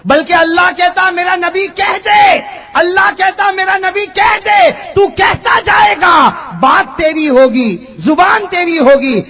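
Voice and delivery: loud, high, drawn-out sermon cry; full-force preaching. Real, not acted. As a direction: thunder each phrase slowly to the congregation. (پورے زور سے بلند خطیبانہ پکار)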